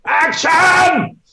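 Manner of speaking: very high, loud screech; piercing mock shout across a set; screeched director's call. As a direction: imitate a director shrieking to start the take. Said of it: "Tom was screeching at the".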